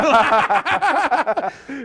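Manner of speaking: high, loud laugh; burst of laughter. Real, not acted. Storyteller laughing at the misnamed goal, loud. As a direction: laugh out at the irony.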